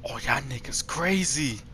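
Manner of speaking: hushed unvoiced whisper. Real, not acted. Player hiding from monsters, whispering. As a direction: keep it down so they can't hear.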